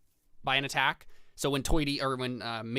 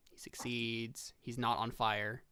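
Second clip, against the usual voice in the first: ordinary delivery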